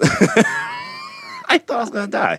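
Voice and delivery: laughing through the words; real laugh while telling of the scare. Real, not acted.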